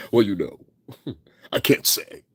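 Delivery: put-on grown-up, articulate delivery; lightly affected mature man, chuckling; mock mature voice. Mimicking the singer trying to sound mature, affected voice. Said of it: "he's trying to make it look mature".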